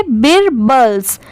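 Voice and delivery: बीरबल्स slowly, ending in a clear 's'; sounded final s. Shown as a demonstration. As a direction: say बीरबल्स as 'beer-buls'. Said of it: "last में स की sound आएगी".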